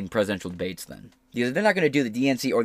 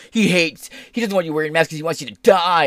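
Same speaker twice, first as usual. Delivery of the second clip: mocking; 6 semitones higher, 6 dB louder than usual. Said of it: "people be like".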